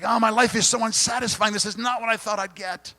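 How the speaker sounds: a hammed-up dissatisfied sigh of a voice; whiny complaint. Speaker imitating people complaining about their life, mock whine. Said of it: "so many of us say".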